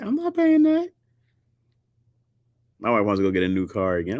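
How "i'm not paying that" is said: much higher outraged refusal; high indignant no. Retold or imitated. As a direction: squeal it in disbelief. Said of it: "You're like".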